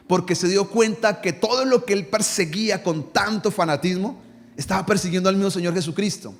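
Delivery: ordinary talk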